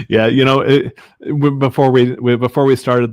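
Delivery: talking loud through a laugh. Real, not acted. Still laughing at host's joke, talking loudly through it.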